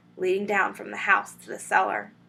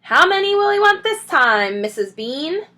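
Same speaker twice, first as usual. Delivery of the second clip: higher, louder call up the stairs; shouted question; 8 semitones higher, 7 dB louder than usual. A narrator's reading. Mabel shouts up the cellar steps to Mrs Bean. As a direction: shout it up to the house. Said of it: "the woman shouted".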